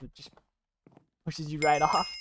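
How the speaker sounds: ordinary delivery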